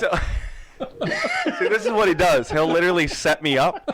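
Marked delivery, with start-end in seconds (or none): 0.2-1.5 high burst of laughter. Real, not acted. Caught off guard by question.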